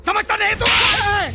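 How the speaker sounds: angry challenge. Acted. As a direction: snap at him to back off. Confrontation turns into a fight.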